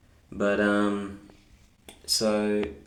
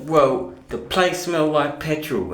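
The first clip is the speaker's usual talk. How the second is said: higher and louder, indignant; mock indignant complaint; 6 semitones higher, 8 dB louder than usual. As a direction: scold him for the smell. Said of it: "she's like".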